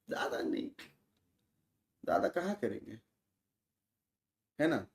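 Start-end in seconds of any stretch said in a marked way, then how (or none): 0.1-0.7 breathy whispered aside. Real, not acted. Streamer whispering an aside to chat. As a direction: drop to a whisper for this bit.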